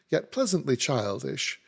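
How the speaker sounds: plain reading voice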